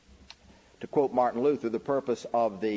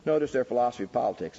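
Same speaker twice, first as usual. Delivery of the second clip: usual speaking voice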